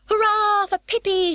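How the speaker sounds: high, loud cheer; cheering shout. A narrator's reading. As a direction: cheer for the winner. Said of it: "shouted Tommy and Annika".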